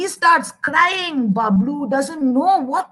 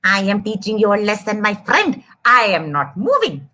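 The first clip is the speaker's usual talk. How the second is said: lower, smug little mouse voice; stubborn mouse; 8 semitones lower than usual. Acted. Mouse refusing to leave the trunk, lower smug voice. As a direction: dig in like a stubborn little pest. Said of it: "Mouse is sitting inside going".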